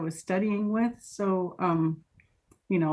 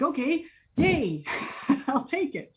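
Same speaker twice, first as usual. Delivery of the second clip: retold glad acceptance; 6 semitones higher than usual. Acting out glad job acceptance.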